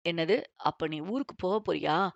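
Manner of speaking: low male voice, mildly worried; lightly voiced worried young man; man asking if she is leaving town; a bit worried. A narrator's reading. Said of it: "சற்று கவலையாக கேட்டான்".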